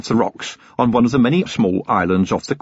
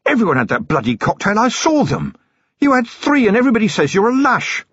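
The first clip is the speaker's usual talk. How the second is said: a beaten thug begging a deal; villain blurting a plea; Stratford, desperate; 12 semitones higher than usual. Acted. Stratford wheezing on floor.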